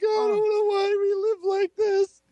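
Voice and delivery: high, wailing imitation of a crying wife; mock crying wife. Husband imitating his wife breaking down in tears, high mock wail. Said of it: "She broke down crying".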